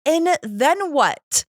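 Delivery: high, drawn-out retold question; exasperated dread. Pressing her sponsor for the next step, dramatised exasperation. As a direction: stretch it out high in mock dismay.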